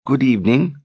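cautious. Acted. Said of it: "in a cautious voice".

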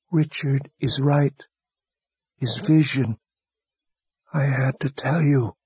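a wounded man using his last strength to speak; dying young wizard. A narrator's reading. Dying Warren voiced.

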